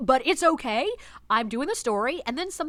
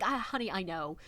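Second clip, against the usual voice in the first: softer, lower, knowing reassurance; soft sympathetic; 5 semitones lower, 8 dB quieter than usual. Retold or imitated. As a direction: console the girlfriend gently. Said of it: "I'm like".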